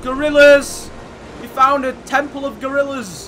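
loud, high thrilled shout; excited shout. Real, not acted. Gamer shouting to friends about the gorilla temple. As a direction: announce the discovery at full volume.

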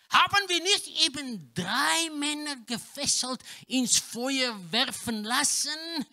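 usual speaking voice

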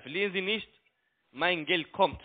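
ordinary delivery

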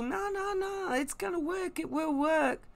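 high, pleading mock voice of a deluded believer; mocking deluded insistence. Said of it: "telling people".

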